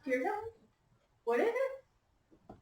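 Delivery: ordinary delivery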